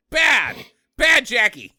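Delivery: loud, high-pitched telling-off; mock scolding. Co-host telling off Jackie, mock pet scolding.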